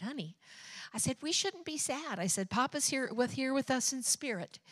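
soft, hushed, tender reassurance; tender comfort. Retold or imitated. Mother gently comforting her sad daughter about Papa, softly. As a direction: gently soothe a sad little girl.

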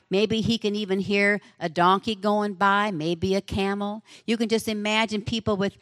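usual speaking voice

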